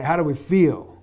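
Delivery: mildly yelled coach's question to the team; retold team yell. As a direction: imitate rallying the runners. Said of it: "but I'd yell".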